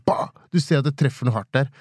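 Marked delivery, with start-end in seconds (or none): 0.1-0.3 explosive "bah" bang; sudden vocal smack; imitated bullet impact. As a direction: mimic a bullet hitting a wall. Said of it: "blir truffet av noe sånn".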